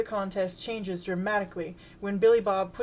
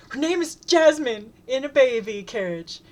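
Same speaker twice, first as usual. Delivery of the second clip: much higher, louder yell of "Her name is Jasmine"; shout; 5 semitones higher, 7 dB louder than usual. Acted. Shouting over the chant.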